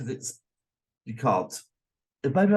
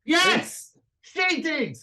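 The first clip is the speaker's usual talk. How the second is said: shrill excited insistence, much higher; 14 semitones higher, slower than usual. Real, not acted. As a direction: blurt it out triumphantly. Insists the character died.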